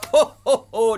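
loud high filler laugh; buy time with a booming ho ho ho; Father Christmas stalling laugh; jolly Santa ho ho ho. Shown as a demonstration. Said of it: "so you need to go".

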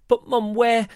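put-on high, loud child's voice; pestering little boy. A narrator's reading. John pestering Mum as she packs, high insistent child. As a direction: pipe up insistently, as if about to ask.

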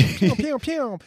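light mouth-made pew shots; soft playful pew noises; pew sound effect. Retold or imitated. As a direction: puff out little toy-gun pews. Imitated spray noise.

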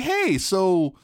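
high, bewildered voice for the friend; a high, puzzled voice for a confused friend; confused friend quote. Retold or imitated. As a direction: play the baffled friend asking questions. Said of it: "She's like".

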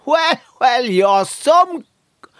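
high, drawn-out Navan greeting; fond ribbing in a broad Navan accent; affectionate Navan accent. Acted. Navan local fondly insulting a pal, high Navan accent. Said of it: "it's a term of affection".

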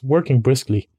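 ordinary delivery

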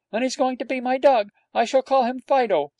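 put-on high, loud child's insistence; a small boy putting his foot down; determined small boy. A narrator's reading. Small boy repeating to his nurse, high and determined.

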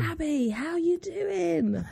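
high, drawn-out instructor call; mimicked shout-out. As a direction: imitate calling across a spin class. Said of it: "She shouts me out".

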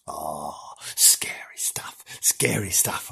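slow hushed whisper of mock dread; spooky whisper. Host telling listeners about the jail ghost, slow mock-scared whisper. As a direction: whisper it like a ghost story by torchlight. Spooky tale of prison governor.

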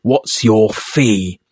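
each word slowed right down, pressing; slow insistent question. Retold or imitated. Mon pressing the thug for his price, slow and insistent. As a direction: drag it out, demanding an answer. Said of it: "And they're like".

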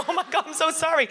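high, laughing apology; mock apology. Apologising to the angry father, a high laughing squeak. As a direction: squeak out a flustered sorry. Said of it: "And I was like".

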